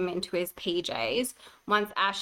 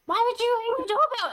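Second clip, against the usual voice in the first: high, sharp mock-angry question; a new mum annoyed at the doorbell, high mock scolding; pissed off; 14 semitones higher, faster than usual. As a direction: retell the snap at the friend. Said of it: "I was so fucking pissed".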